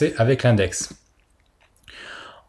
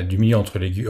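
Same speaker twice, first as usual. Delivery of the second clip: normal talking voice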